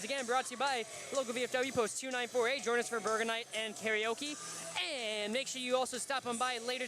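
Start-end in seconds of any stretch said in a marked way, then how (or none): none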